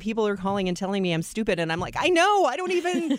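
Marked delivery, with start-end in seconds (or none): 2.0-3.1 squeaky, much higher exasperated whine; miserable whine. Retold or imitated. Telling the host how she answered callers, in a high whine. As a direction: squeal it like you're at the end of your rope. Quoting her miserable past self.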